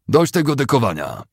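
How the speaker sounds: high, loud angry shout. A narrator's reading. Officer to a straggling soldier, angry shout. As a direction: yell at the shirker. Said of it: "krzyknął".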